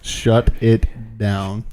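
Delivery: very slow, each word stretched; drawn-out command. Pretend. Co-host ordering the phone shut down, slow drawn-out command.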